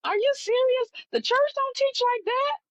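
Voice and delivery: high, incredulous squeal; reacting to what the church hid in a high, disbelieving voice; disbelief. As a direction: sound utterly floored. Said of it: "And I'm like".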